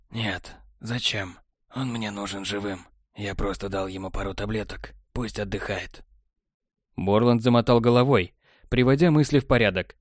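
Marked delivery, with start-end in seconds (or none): 0.1-6.0 lower, much softer, cool and smug; cool technician. A narrator's reading. Technar answering Borland, low smug and soft.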